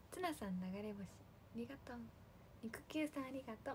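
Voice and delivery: ordinary delivery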